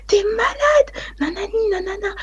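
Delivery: high shrieking cry; screaming. Retold or imitated. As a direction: shriek it in shock. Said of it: "la meuf va crier".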